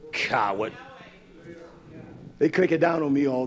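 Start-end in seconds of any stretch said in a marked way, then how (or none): 0.1-0.7 scornful. Real, not acted. Caller hung up.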